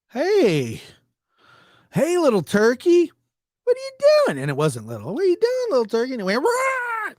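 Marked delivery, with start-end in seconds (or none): 0.1-4.4 high, sing-song coaxing; baby talk to a turkey. Retold or imitated. Talking sweetly to a turkey. 5.1-6.1 high, sing-song coaxing; baby talk to a turkey. Retold or imitated. Talking sweetly to a turkey. 6.4-7.1 sharp high mock squawk; turkey squawk. Turkey's threat noise.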